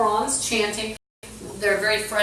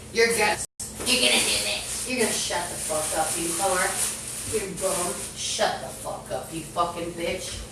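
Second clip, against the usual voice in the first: hissing imitation; 6 dB quieter than usual.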